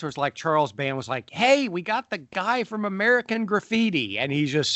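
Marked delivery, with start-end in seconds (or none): 1.3-4.2 high-pitched excited imitation of a producer; mock-excited, announce a proud casting coup; pitched-up, eager imagined quote; excited boast. Imagining the filmmaker boasting about casting.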